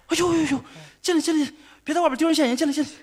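a hint of a flustered wife hushing him in; lightly voiced wife, mildly alarmed, pulling him inside; scared wife. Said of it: "当时我媳妇儿就吓坏了". (略带惊慌的媳妇儿，小声催他进门)

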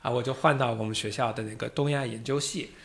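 ordinary delivery

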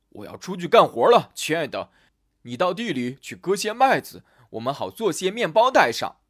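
loud, projected husband's voice; hearty instruction. A narrator's reading. Husband heads out to work, loudly giving instructions. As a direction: announce it loudly to your wife. Hans instructs his wife before leaving.

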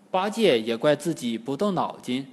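plain reading voice

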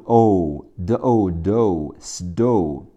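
"O" held long, blended into "do", then "stone"; sounding out. Shown as a demonstration. Teacher blending sounds for learners, drawn-out "do", "stone". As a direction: slowly build "的O", "do", "stone" with a long o. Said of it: "O读O".

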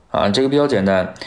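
ordinary delivery

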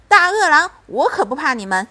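loud, high call-out, then quick; defiant warning shout; bold defiance. A narrator's reading. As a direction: stand up to the beast boldly. Mother demonstrates scaring wolves.

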